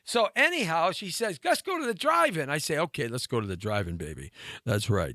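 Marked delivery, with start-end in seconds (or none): 1.4-2.5 high mock girlfriend invitation; put-on girly coaxing; imitated girl. Quotes the girl's invitation. 2.8-5.1 put-on low suave teenage Romeo; put-on deep ladykiller voice. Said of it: "I had that deep voice".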